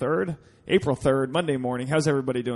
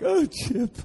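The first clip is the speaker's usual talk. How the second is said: mock heartbroken; 12 semitones higher than usual. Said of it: "I'm like".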